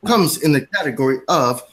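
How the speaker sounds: ordinary delivery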